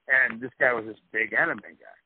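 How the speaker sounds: usual speaking voice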